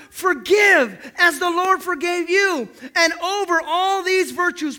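loud, high, driving sermon delivery; forceful scripture preaching, ringing out; impassioned preaching. Real, not acted. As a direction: proclaim it to the whole congregation.

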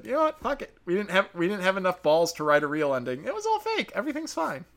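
high-pitched mocking squeak; mimicking the writers in falsetto; mock filmmaker. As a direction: put on a high, silly cop-out voice. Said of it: "and then you said".

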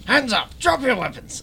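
high, loud soldier order; commanding military voice. Acted. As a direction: bark it like a soldier. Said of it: "commanding military voice".

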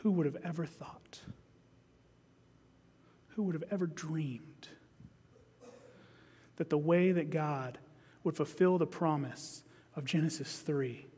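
soft, near-whispered wonder; hushed awe. Real, not acted. Preacher to congregation, hushed in awe. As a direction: marvel quietly at the mystery. Awed reflection after scripture.

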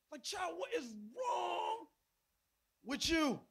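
high, drawn-out exasperated scolding; imitating an exasperated dad; frustrated parent. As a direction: drag it out slowly in acted disbelief at the child. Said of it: "'cause I was frustrated by the decision".

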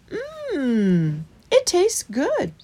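long hum, then high, slow praise; savouring mouse. A narrator's reading. Christopher tasting the badger's water, high and relishing. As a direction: savour it with a drawn-out mmm.

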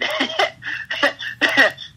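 breathy burst of giggles; helpless laugh. Real, not acted. Wrestler remembering a crazy road trip, helpless laugh. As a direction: crack up at your own memory. Breaks up recalling the road story.

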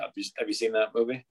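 ordinary talk, nothing marked

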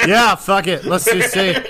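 laughing while agreeing. Real, not acted. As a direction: talk through a laugh.

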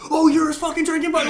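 high, loud sarcastic accusation; mock outraged sergeant. Sergeant to recruit saying Chesty, mock outrage. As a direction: pounce with hammed-up indignation.

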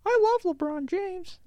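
put-on very high little kid voice, unhurried. Kid tells the delivery man he likes his hat, high kid voice. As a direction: chirp it like an eager little boy.

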